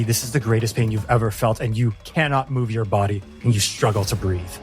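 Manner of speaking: ordinary talk, nothing marked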